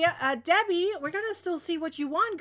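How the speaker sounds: ordinary delivery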